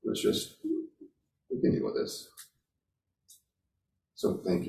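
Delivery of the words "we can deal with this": softly said, almost whispered acceptance; calm acceptance. Retold or imitated. Student recalling the group coping with a setback, calmly accepting. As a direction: say it softly, without any fuss. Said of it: "It wasn't a lot of gnashing of teeth".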